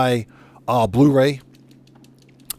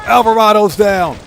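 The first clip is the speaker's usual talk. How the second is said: raised, high callout; calling out; 7 semitones higher, 7 dB louder than usual. Real, not acted. Streamer announcing a kill in game, calling out. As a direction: call it out high and loud.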